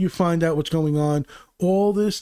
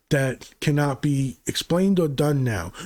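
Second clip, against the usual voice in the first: ordinary talk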